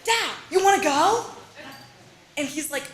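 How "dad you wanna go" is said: higher, louder eager offer; eager invite. Retold or imitated. Daughter eagerly inviting her dad out, higher and louder. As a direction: pitch the outing brightly. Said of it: "And I thought".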